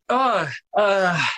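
high, startled stammer; retold flustered high voice; flustered reaction. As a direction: act caught off guard mid-daydream. Flustered actor caught missing his line.

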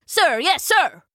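high, crisp military reply; little soldier ant. A narrator's reading. Ant answering the old hornet, crisp soldier voice. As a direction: snap it out smartly. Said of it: "he piped in his little voice".

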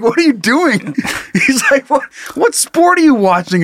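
high, put-on baffled brother voice; teasing brother. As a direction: brother baffled by the muted chat. Brother quoted, laughing.

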